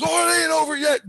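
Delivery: high-pitched imitated holler; taunting mock shout. Jesus warning the dreamer in a retold nightmare, high mock shout.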